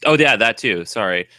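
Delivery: ordinary talk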